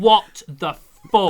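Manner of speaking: very slow, loud stunned yell; shocked shout. Retold or imitated. Reliving his shock at the game, loud and slow. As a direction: drag out the shock. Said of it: "I was like".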